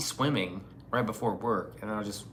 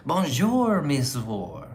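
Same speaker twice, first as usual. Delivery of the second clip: mock French greeting; 4 semitones higher, 6 dB louder, slower than usual.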